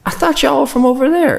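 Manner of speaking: very high, insistent Southern lady; Southern accent. Retold or imitated. Southern lady doubting Muslims are local, Southern accent. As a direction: mimic her high Southern twang. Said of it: "in a very Southern accent".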